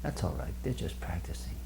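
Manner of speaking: soft, unruffled reassurance; very calm. Retold or imitated. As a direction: answer the complaint quietly and calmly. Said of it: "looked at him very calmly and he said".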